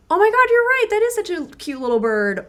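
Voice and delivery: high, bright burst of delight on "Oh my God"; squealing "you're right" to a partner about a bird; excited agreement. Acted. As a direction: gush back at your partner's find. Acted partner meeting the bid.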